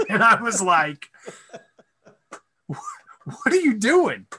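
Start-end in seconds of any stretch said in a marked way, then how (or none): 2.7-4.3 high, drawn-out incredulous question; appalled disbelief. Retold or imitated. Son to dad after his outburst at a customer, appalled. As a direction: stretch it out, pitched way up in disbelief.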